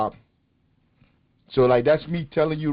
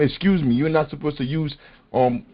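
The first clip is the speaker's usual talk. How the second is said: normal talking voice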